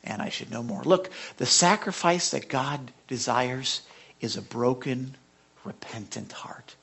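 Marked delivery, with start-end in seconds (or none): 5.6-6.8 hushed whisper. Acted. Pastor to congregation on repentance, whispered. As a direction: breathe "repentant heart" in a slow, low whisper.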